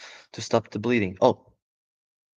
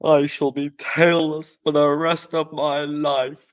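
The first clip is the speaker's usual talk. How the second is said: glum father fox; 4 semitones higher, 5 dB louder than usual. Acted. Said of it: "said Mister Fox".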